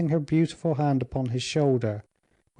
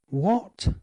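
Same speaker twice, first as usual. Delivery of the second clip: higher, startled What!; shocked exclamation; 8 semitones higher than usual. Acted. Duke hears he must leave for London, shocked outcry. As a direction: jolt up in disbelief. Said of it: "he again exclaimed".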